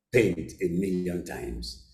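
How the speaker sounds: ordinary talk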